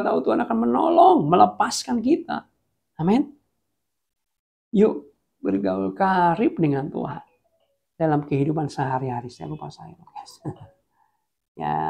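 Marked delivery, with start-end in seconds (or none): none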